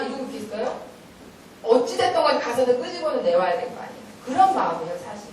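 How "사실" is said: ordinary delivery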